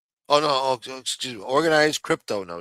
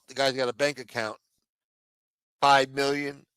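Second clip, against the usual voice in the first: normal talking voice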